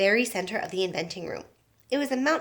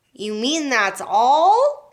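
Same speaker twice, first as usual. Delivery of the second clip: slow, louder let-down drawl; disgusted; 3 semitones higher, 8 dB louder, much slower than usual. A narrator's reading. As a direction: drag it out, unimpressed and fed up.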